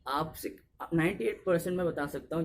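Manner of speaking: usual speaking voice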